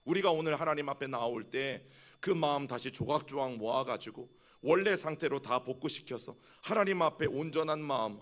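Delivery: ordinary talk, nothing marked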